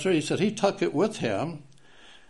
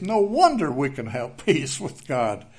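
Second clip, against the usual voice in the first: ordinary talk, nothing marked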